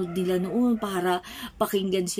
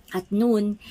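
normal talking voice

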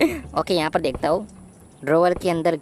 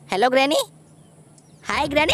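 usual speaking voice

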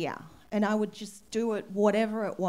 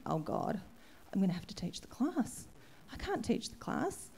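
panicked whispered thought; 2 semitones lower, 8 dB quieter than usual. Retold or imitated. Panicked inner thought, retold.

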